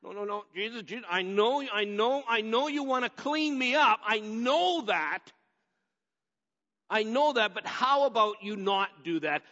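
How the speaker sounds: high, loud pleading no; a churchgoer brushing off Jesus, pleading loudly; proud refusal. Retold or imitated. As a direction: insist you'll clean yourself. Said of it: "what we tend to say".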